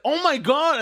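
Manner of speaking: put-on high shocked child gasp; shocked kid voice. Kid's shocked reaction.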